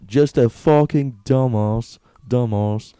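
drawn-out mock British dumbass; mock British accent. Podcaster mocking British dudes to his friend, slow British accent. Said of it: "every British dude is".

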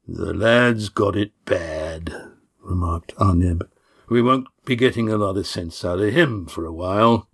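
put-on amused old man remark; older man voice. Arneb voiced lower, slow.

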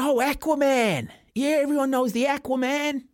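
high, hammed-up American southern drawl. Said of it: "Sort of said it more with a southern drawl".

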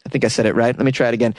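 ordinary talk, nothing marked

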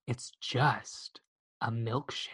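low, very soft imitated whisper; flirty whisper. Crush leaning in to Max, flirty whisper. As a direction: lean in and murmur it suggestively. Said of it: "She whispers, she's like".

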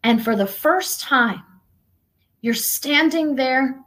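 ordinary delivery

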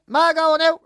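high, loud holler to Ma; parrot mimicking kids. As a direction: shout it like a parrot copying the children. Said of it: "He memorised all the kids' voices".

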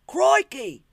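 high, shouted Australian wildlife-host outburst; mock Australian accent. Host exclaiming over high animals, shouted mock Australian. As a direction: holler it in hammed-up Australian.

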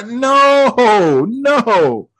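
high, drawn-out refusal; mock alarm. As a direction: wail it in comic dread. Acting refusal at scary height.

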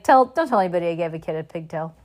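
quick, put-on Hagrid mimic; mother mocks Hagrid's secret plea to her child; imitating Hagrid. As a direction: rattle it off like Hagrid begging you. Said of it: "He always, like".

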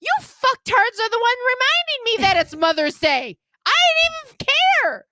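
put-on high, indignant outburst at the kids; exasperated rant. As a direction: vent at them, hurt and fed up.

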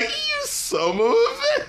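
high, slow, hammed-up betrayed cry; mock outrage. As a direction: play the betrayal big and slow. Mock betrayed glance back.

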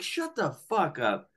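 high, drawn-out retold disbelief; replayed incredulous reaction, slow and high. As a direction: stretch it out, acting stunned. Retells own disbelief at news.

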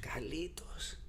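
soft, whispered Spanish name; hushed, tender-style imitation of grandma; grandmother's call. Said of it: "But she always used".

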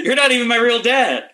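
put-on high, loud bratty kid protest; mock defiant child. Refusing a dare to watch Avatar, mock defiant child. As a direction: throw a hammed-up child's tantrum.

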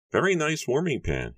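very high, measured appraisal; Matthew's character voice. A narrator's reading. Matthew admires pan, high voice.

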